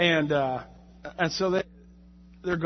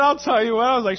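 ordinary delivery

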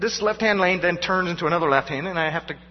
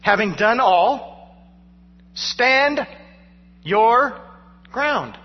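having done all, then stand, your, ground each set apart, slow and higher; slow emphatic stress; 3 semitones higher, much slower than usual. Shown as a demonstration. Pastor unpacking the verse, slow deliberate emphasis. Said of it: "It literally means".

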